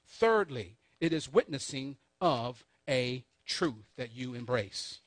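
deliberate, emphatic dictation with long pauses; slow recap. Shown as a demonstration. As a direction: stretch out the key words point by point. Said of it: "let me give it to you one more time".

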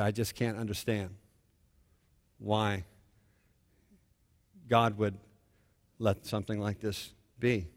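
low, soft, halting lament; retold bewildered grief. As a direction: quietly imitate a father struggling to understand. Retelling a bereaved father's anguish.

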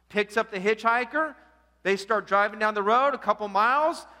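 ordinary talk, nothing marked

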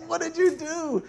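high, laughing question; laughing disbelief. Retold or imitated. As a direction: ask it half-laughing in disbelief. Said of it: "You're like".

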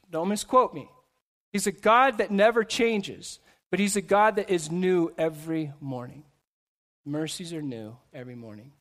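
ordinary delivery

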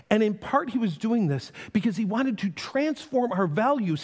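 ordinary delivery

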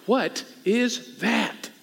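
slow, drawn-out disbelief; acted bafflement, word by word; astonished onlooker. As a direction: space out each word, incredulous. Acts onlookers astonished at passion.

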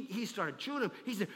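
ordinary talk, nothing marked

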